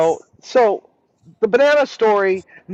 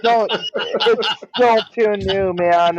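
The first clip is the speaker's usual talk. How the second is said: laughing through the words, a little slower; laughing embarrassed; slower than usual. Real, not acted. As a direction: giggle it off, a bit embarrassed.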